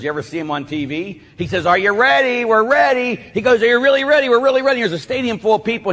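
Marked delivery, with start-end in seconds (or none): 1.6-3.2 high, loud stage-preacher hype; Benny Hinn. Retold or imitated. TV preacher rousing a stadium, hyped-up shouting. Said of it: "Benny Hinn performs miracles". 3.6-4.8 high, loud stage-preacher hype; Benny Hinn. Retold or imitated. TV preacher rousing a stadium, hyped-up shouting. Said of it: "Benny Hinn performs miracles".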